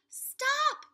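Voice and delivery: sudden high, loud shout. A narrator's reading. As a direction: yell to cut her off. Jack stops Annie's wish.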